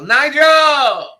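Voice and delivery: high, loud sung-out name call; imitating the guy. Imitating the Dunkin' worker calling out a name. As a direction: belt the name like the quirky manager.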